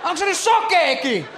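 hammed-up translator chatter; mock excited commentary. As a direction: blurt it out like a confused viewer.

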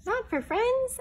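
high squeaky questioning; squeaky surprise. Acted. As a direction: ask in a tiny amazed voice. Mouse surprised, first take.

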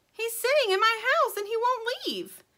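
tiny bird complaining high and loud; little bird voice. Acted. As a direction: chirp it in a worried rush.

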